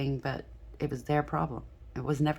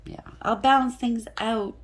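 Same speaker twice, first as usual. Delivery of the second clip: higher, louder put-on voice of the ex making promises; voicing the ex; 8 semitones higher, 6 dB louder than usual. Tarot reader mimics the partner promising to stop drinking, raised mock voice. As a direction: play the ex swearing he'll quit drinking, raised and loud.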